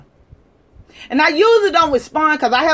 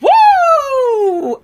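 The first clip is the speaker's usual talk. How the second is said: high, loud "woo" whoop; high-pitched laughing woo; 12 semitones higher, 6 dB louder than usual. Real, not acted. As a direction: let out a gleeful holler.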